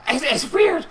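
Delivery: high, loud, swaggering goblin-lord voice; Little G. Acted. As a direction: boast like a brutal new boss. GM holds Little G's voice.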